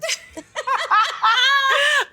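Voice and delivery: sudden high burst of laughter; big laugh. Real, not acted. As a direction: laugh out loud at the dancing joke. Host jokes about dancing.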